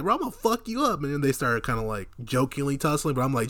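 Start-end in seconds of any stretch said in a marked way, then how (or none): 0.0-1.0 mock threat. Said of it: "he was like".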